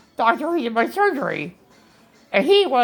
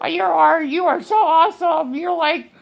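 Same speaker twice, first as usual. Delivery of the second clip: imitating delighted surgeon; 7 semitones higher than usual. Patient quoting the hugging surgeon, mock gushing delight. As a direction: gush like a proud doctor. Surgeon's happy praise voiced.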